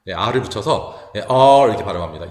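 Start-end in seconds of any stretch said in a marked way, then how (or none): 1.3-1.7 'R' as a drawn-out English 'ar'; r-coloured ah. Shown as a demonstration. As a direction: say 'R' as 아 curling into r. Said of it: "R이 붙게 되면 이제 아 발음". ('R'을 아에 r을 붙여 길게)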